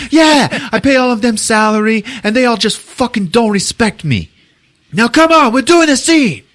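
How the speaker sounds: high, loud hammed-up rant; imitated yelling. Director orders everyone back to the scene, yelling. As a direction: yell like a director at the end of his patience. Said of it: "he's yelling at Greg".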